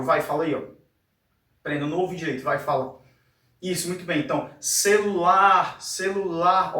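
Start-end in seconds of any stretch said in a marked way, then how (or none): 4.6-6.8 "celular" called out, last syllable "lar" stretched long; calling out. Shown as a demonstration. Teacher showing how to find the stressed syllable, "celular" called out with long "lar". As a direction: call "celular" like a name, holding "lar". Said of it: "Fala como se estivesse chamando a palavra".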